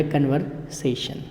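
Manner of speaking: kən- with the R, trailing off quietly. Shown as a demonstration. Teacher mocking the made-up Indian accent softly. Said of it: "ये हमने अलग accent बना दिया".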